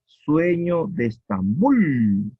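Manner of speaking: "Sueño de Estambul" slow, bul stressed; slow scansion demo. Shown as a demonstration. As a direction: slowly land hard on bul in "Estambul". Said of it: "en bul está el acento".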